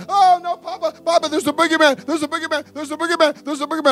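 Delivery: put-on high, frantic little-kid panic; upset children. Upset kids tell Papa about the boogeyman, panicked. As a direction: imitate a scared child, repeating it in a high voice. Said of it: "when they're upset".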